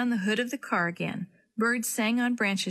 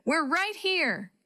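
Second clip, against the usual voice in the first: calling back; 8 semitones higher, much slower than usual. A narrator's reading. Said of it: "Willie called back".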